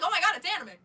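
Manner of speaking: loud excited gasp; surprised delight. Retold or imitated. Seeing the anime drawing, excited. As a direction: exclaim it in delight.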